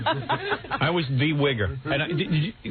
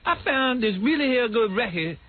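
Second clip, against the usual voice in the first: higher, slow mock street talk; a hint of teen slang; put-on slang voice; 9 semitones higher, slower than usual. Kid presenting his record to class in slang. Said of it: "I'm like".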